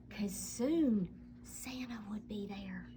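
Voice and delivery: whispered, as if Santa might hear; hushed whisper. A narrator's reading. Narrator whispering to listening children that Santa is coming, hushed whisper. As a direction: breathe it like a secret.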